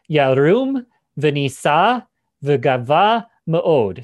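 "Yarum v'nisa v'gava ma'od" recited slowly and loudly in Hebrew; emphatic Hebrew. Shown as a demonstration. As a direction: intone "Yarum v'nisa v'gava ma'od" syllable by syllable, slow and strong. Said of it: "in Hebrew, it's quite emphatic".

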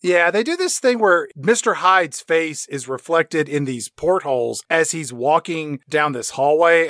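usual speaking voice

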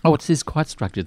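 ordinary talk

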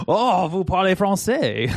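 high, slow, delighted surprise in French; mock the clients' pleased astonishment; surprised French reply. French Canadian client on the call, amazed he speaks French, surprised.

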